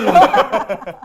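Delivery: big burst of laughter; loud laugh. Real, not acted. Host laughs at joke.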